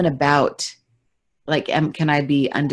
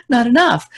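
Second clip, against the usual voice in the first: gasp, then a crying not enough; high gasp then wail not enough; mock gasp of panic; 7 semitones higher, 5 dB louder than usual. Podcaster mocking her own panic at being in demand, gasping and wailing. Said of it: "and I'm like".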